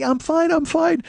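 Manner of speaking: very high, tearful squeak; hammed-up squealed reassurance, loud; mock laugh-crying. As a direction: imitate choking out words between sobs and laughs. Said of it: "laughing and crying at the same time".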